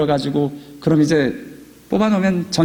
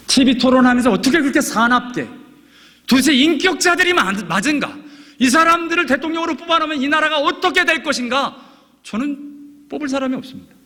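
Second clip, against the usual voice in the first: raised, ringing preacher's voice, much higher; fervent preaching; 11 semitones higher than usual. Acted. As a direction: drive the point home from the pulpit. Preaching at full force.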